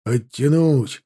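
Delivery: mild shout, building from low; called out. A narrator's reading. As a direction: call to a fellow rider, restrained. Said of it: "крикнул скачущий рядом".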